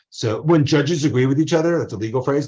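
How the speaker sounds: ordinary talk, nothing marked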